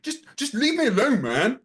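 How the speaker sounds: high, stammering imitation of a flustered man; mock exasperated plea. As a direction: plead to be left alone, high-pitched and hammed-up. Acted quote of flustered opponent pleading.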